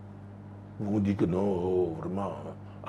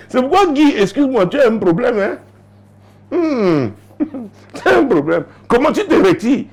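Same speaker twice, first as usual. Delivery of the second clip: much higher, louder playful scolding; excited teasing; 14 semitones higher, 5 dB louder than usual. Real, not acted. Preacher to husband Guy, loud laughing scolding. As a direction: scold him while laughing.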